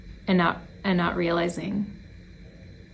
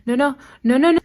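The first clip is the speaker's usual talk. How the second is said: mock panicked pleading; 6 semitones higher, 4 dB louder, faster than usual. Student panics as she reaches phone.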